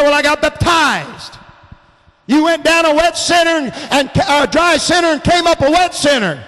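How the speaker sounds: fervent revival preaching; preaching at full force. Acted. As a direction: preach it hard to the congregation.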